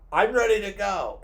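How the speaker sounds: mocking the sister. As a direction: sneer it out like an impatient sister. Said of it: "my bitch sister is just like".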